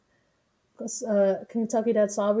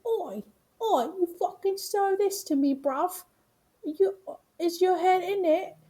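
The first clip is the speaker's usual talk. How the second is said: high-pitched mock British chef rant; exaggerated British "oi, bruv" telling-off; Gordon Ramsay; 9 semitones higher than usual. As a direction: berate them as a hammed-up angry chef. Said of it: "imagine, like, Gordon Ramsay being your dad".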